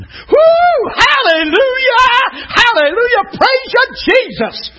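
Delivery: high, loud hallelujah shouts; ecstatic shouting. Acted. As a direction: whoop and holler praise like an overjoyed believer. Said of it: "started shouting".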